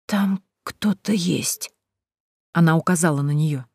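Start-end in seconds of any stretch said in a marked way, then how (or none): 0.1-1.7 slow, hushed dread; drawn-out uneasy warning; frightened warning. A narrator's reading. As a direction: draw out each word warily, as if something is listening.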